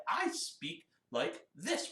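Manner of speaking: measured spoken demonstration; speaking voice. Shown as a demonstration. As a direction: speak it slowly, word by word. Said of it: "you should have said".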